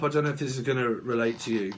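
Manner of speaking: normal talking voice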